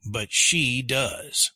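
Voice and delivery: but she does slowed, she leaned on; teacher contrasting I do with she does; slow stress on she does. Shown as a demonstration. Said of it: "So it's I do or you do".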